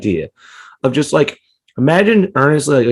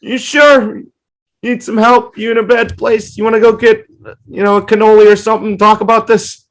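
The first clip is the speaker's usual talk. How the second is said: lightly hammed worried friend, pitched up; mock concerned friend; 8 semitones higher, 4 dB louder than usual. Friend on the phone fussing over him, high mock worry. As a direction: pile on the fake concern. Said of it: "someone needs to call me and go".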